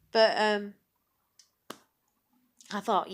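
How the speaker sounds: usual speaking voice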